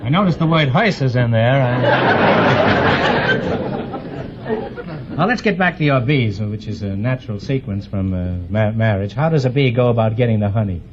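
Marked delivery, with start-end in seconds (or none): 1.9-5.1 audience laughter. Real, not acted. Audience laughs at hearse pun.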